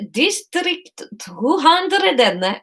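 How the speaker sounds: put-on high Spanish accent drifting into Russian; attempted Spanish accent. Courier reading a label, Spanish accent gone Russian. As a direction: drag it out in a botched Spanish accent. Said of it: "this is Russian".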